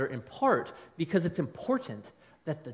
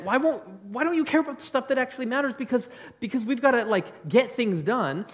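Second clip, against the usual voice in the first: raised, louder-edged frustrated plea; mildly exasperated, higher-pitched complaint; 6 semitones higher, 4 dB louder than usual. Acted. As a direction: act out an exasperated teammate pushing to get on with it. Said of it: "this one is frustrated".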